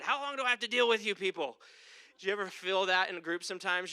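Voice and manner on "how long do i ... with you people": fast, raised, exasperated outburst; mock exasperation at hopeless followers; exasperated Jesus. Jesus fed up with his disciples, exasperated outburst. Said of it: "And he said".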